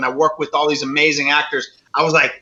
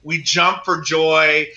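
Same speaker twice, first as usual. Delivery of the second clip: usual speaking voice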